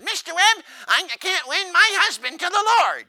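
high, loud put-on lady voice; whiny rich lady. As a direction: plead in a high society-lady voice.

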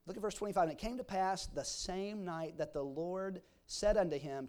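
ordinary delivery

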